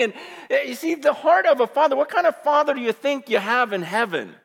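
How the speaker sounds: normal talking voice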